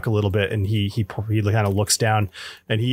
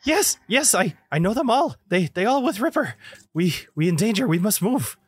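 NPC voice; 8 semitones higher than usual. Acted. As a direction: speak broken English, pitched up high. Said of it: "She said".

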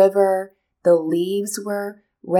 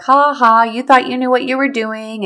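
louder, higher taunting ha-ha; imagined mocking; 4 semitones higher, 5 dB louder than usual. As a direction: jeer like teasing relatives in your head. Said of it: "Are people gonna laugh at me".

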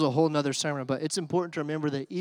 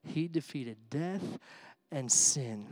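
slow, hushed proclamation; 3 semitones lower, 7 dB quieter, much slower than usual. Acted. Solemn climax of the sermon.

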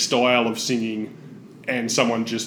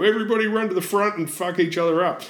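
mock stage yell; 6 semitones higher, faster than usual. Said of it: "they get on stage and yell".

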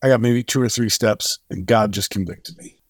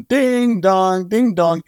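high, lilting ding-dong; playful singsong; 7 semitones higher, much slower than usual. Retold or imitated. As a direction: imitate a swinging bell for a child. Playfully swinging the child around.

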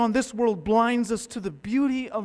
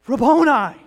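loud, higher cry of recognition; joyful recognition; 6 semitones higher, 15 dB louder than usual. Acted. Mary recognizing her risen teacher, joyful cry. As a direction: burst out as you know him.

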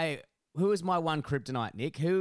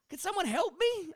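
high desperate call for help; mock helpless cry; helpless plea. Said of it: "I was like".

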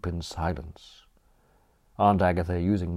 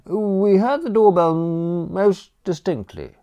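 put-on high, nervous young gent; flustered Bertram; 11 semitones higher, 4 dB louder than usual. Bertram to the butler after the row, high nervous question. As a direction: stammer out the question anxiously.

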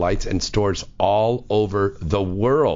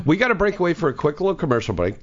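rushed; 8 semitones higher, much faster than usual. Real, not acted. Rushing into the ad break.

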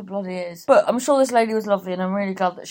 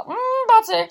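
knowing tease; 11 semitones higher, 5 dB louder than usual. Retold or imitated. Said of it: "so I was like".

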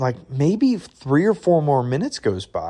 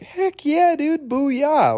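jubilant cheer; much slower than usual. Retold or imitated. Told by gate agent he gets on, high whooping cheer. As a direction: squeal it like you just won. Told he can board the plane.

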